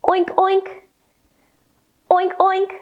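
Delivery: high playful oinking; pig oinks. Shown as a demonstration. Teacher leads children rolling like pigs, oinking. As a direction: oink like a muddy pig. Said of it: "give me an oink".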